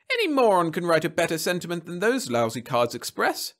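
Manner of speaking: high, indignant scoffing; scornful. Acted. Client deriding greeting cards to supervisor, scornful. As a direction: scoff at it heatedly.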